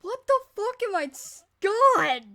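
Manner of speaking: loud high exasperated shout; frustrated yell. Real, not acted. Frustrated at her own reading.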